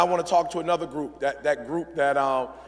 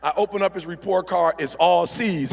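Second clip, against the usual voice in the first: ordinary delivery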